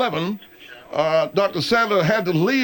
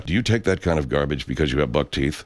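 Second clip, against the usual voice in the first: low, smooth commercial announcer voice; deep parody commercial voiceover; mock ad read; 11 semitones lower than usual. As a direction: sell the buck-teeth restaurant like a radio spot.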